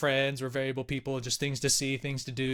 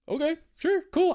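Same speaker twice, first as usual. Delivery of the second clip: high, bemused okay; surprised agreement; 13 semitones higher than usual. Retold or imitated. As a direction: go along, pitched way up in surprise. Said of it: "I'm like".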